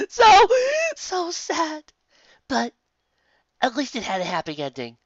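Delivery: high, loud, wobbling tearful voice; weepy listener after a sad story; tearful sniffling. Acted. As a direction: blubber through the tears. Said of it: "Twilight sniffed, body racked with sobs".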